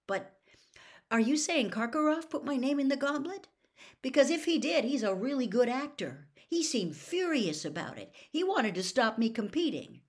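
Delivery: put-on an agitated boy pressing his godfather; young Harry. Harry questions Sirius anxiously.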